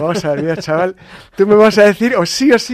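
loud, high mock intimidation; retold threatening demand. Priest cornering the delivery man, hammed-up threatening demand.

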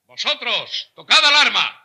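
high, loud call to arms; battle command. Acted. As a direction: shout the order to the troops. Ordering soldiers to arms. (orden de batalla a gritos)

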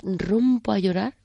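normal talking voice

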